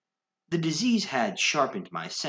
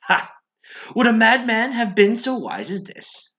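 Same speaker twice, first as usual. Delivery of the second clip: much higher and louder manic cry; triumphant outburst; 12 semitones higher, 9 dB louder than usual. Acted. Madman boasts to the listener in a manic, crazed shout.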